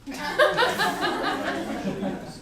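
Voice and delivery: loud high laugh breaking out; burst of laughter. Real, not acted. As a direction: crack up laughing. Laughs at son joke.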